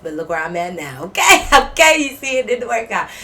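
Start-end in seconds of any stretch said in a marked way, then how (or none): 1.0-3.1 laughing. Real, not acted. Laughs at own story.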